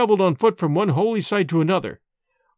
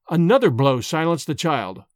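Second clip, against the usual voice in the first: ordinary delivery, nothing marked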